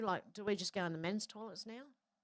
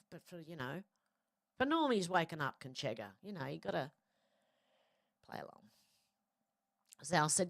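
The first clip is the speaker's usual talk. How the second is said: normal talking voice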